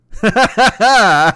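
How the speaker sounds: loud, high showman's cackle; big mock laugh to cap the bit; hammed-up comic laugh. Caps the Shecky Schmidt bit.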